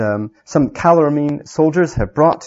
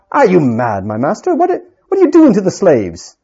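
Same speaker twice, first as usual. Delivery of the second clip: sharp soldier; 6 semitones higher, 5 dB louder than usual. Acted. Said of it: "said sharply".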